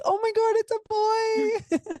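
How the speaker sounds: gasping in joyful surprise; ecstatic, drawn-out exclamation; delighted shock. Retold or imitated. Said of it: "I was like".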